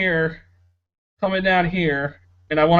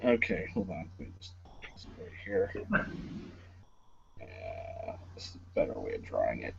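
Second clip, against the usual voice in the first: very low, barely audible murmur; muttering to self; 18 dB quieter than usual. Real, not acted. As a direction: think aloud under your breath while busy drawing. Muttering to himself while drawing.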